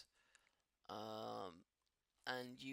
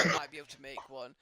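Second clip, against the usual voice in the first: usual speaking voice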